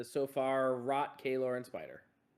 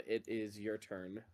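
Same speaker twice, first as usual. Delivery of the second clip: usual speaking voice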